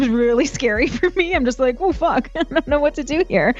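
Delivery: usual speaking voice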